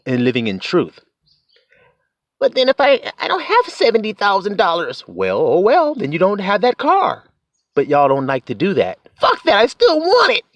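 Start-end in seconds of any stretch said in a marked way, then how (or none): 2.4-5.0 high-pitched protesting car buyer; whining objector. Acted. Imagined car buyer objecting to the seventy thousand dollars, high whiny protest. 9.2-10.4 very high mock-defiant voice; stubborn retort. Car buyer refusing to give up the car, high mock defiance.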